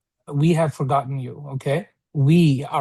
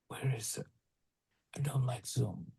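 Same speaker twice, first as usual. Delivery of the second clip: whisper; 2 semitones lower, 16 dB quieter than usual. Real, not acted. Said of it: "unvoiced whisper".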